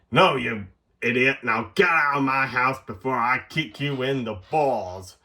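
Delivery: bossy landlady telling someone off. Acted. Landlady ordering the sheriff out of her house, bossy. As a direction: put on a scolding landlady voice. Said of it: "bossy lady".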